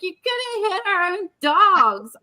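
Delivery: high, upset reproach; upset scolding. Retold or imitated. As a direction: blame him sharply. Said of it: "I'm like".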